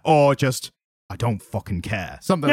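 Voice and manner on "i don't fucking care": Alan Moore impression. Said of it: "we both do an Alan Moore impression".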